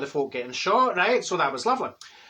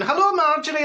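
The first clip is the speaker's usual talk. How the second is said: cheery greeting; 10 semitones higher, 5 dB louder than usual. Retold or imitated. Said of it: "they're going".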